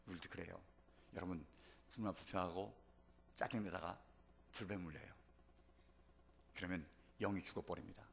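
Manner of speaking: low, soft, solemn caution; quiet warning. Real, not acted. Preacher warning congregation about grumbling and fiery serpents, hushed and low. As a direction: drop right down and warn them gravely.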